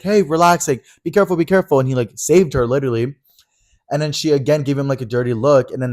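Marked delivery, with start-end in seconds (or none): 0.0-1.8 urgent warning. Retold or imitated. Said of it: "grabbed her and was like".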